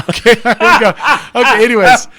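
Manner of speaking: laughing loud and high, caught by his own joke; breaking up laughing, then trying to move on. Real, not acted. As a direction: laugh it off and try to get back on track.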